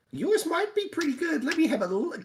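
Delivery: high, sweet fake politeness; pretending to savor it, voice way up; mock polite taster. Mocking a polite guest who tastes a bad sandwich. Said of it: "you'd be like".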